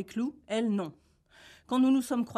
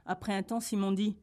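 usual speaking voice

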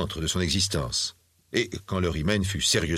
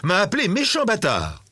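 put-on high-pitched boy telling on someone; acted little boy, voice pitched up; child's voice; 7 semitones higher than usual. A narrator's reading. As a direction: complain to mother as a wronged child. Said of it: "poursuivit l'enfant".

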